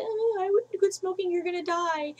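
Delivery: put-on high, whiny little-kid voice; imitating her son. Son begging mom to quit smoking, high childish whine. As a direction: pitch up into a pleading child.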